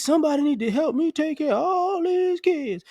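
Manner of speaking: high, whiny mock plea. Mother in a hotel room full of kids begging for help, high and whiny. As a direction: whine like an overwhelmed mother.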